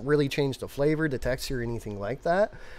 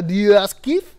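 Brewers Cafe in a put-on French accent, louder; 6 semitones higher, 9 dB louder, slower than usual. Shown as a demonstration. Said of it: "you get a little bit into the French".

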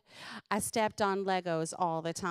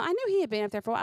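usual speaking voice

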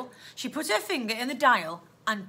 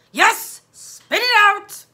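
loud, slow, drawn-out demand; loud, impatient police officer; impatient policeman; 10 semitones higher, 12 dB louder, much slower than usual. Acted. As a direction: bark it down the line. Impatient policeman pressing the girl.

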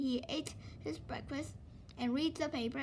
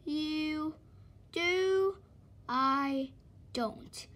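drawn-out, stressed you do; slow retort; 3 semitones higher, 3 dB louder, much slower than usual. Acted. Mister Slow stubbornly answers Mister Busy, slowly. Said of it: "said Mister Slow".

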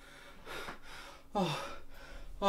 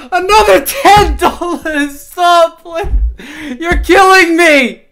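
half-laughing cry of stop, much higher and louder; overwhelmed laughing protest; 7 semitones higher, 7 dB louder, slower than usual. Real, not acted. Streamer begging donors to stop, laughing and loud. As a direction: beg them to stop, laughing.

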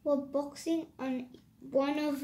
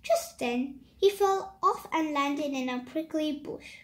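plain reading voice

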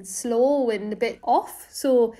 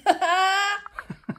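laughing; 7 semitones higher than usual. Real, not acted. Laughing at her joke.

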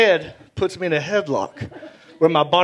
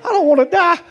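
high, louder mock panic; panicked thought; 11 semitones higher, 4 dB louder, faster than usual. Choked kid's panicked thought, high mock panic. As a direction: squeal it like he's about to die. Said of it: "I'm thinking".

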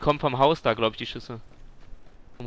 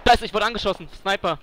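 alarmed shout; 7 semitones higher, 6 dB louder than usual. Real, not acted. Just got shot.

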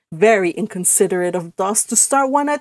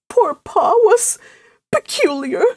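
tearful; 9 semitones higher, 4 dB louder than usual. A narrator's reading. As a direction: admit it reluctantly through tears. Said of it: "admitted Missus Frederick tearfully".

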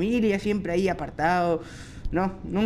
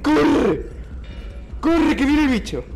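panicked shout; 6 semitones higher than usual. Real, not acted. Player fleeing the creature, panicked shouting. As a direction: urge them on in a panic.

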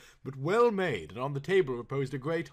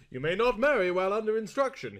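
firm; 7 semitones higher, 5 dB louder than usual. Acted. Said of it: "he said firmly".

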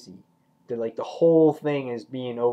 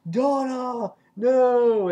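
high, drawn-out cry of protest; horrified cry; 12 semitones higher, 4 dB louder, much slower than usual. Retold or imitated. Alan sees Donna attacked by zombies, high horrified cry. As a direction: call out in acted horror. Man calls out as woman is attacked.

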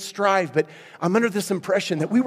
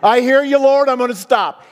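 excited; 7 semitones higher, 8 dB louder than usual. Real, not acted. Laughing exchange with God.

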